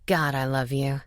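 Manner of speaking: low, heartfelt hero voice; loving male lead. A narrator's reading. Hero declares love.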